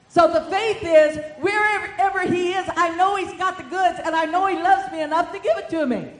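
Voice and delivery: loud, high preaching cry; full-force preaching. Real, not acted. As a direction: preach it out to the church.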